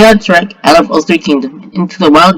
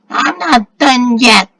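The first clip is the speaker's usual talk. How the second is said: slow, high screeched defiance; 5 semitones higher, much slower than usual. A narrator's reading. Harpy gets back up after falling, slow high screech. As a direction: screech it out, dragging each word.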